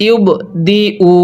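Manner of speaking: normal talking voice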